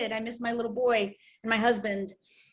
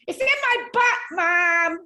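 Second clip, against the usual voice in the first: put-on high, loud kid whine to Mom; imitated little boy; 7 semitones higher, 5 dB louder, slower than usual. Son answering his searching mom, imitated high and loud. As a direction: call it out like a cheeky small child.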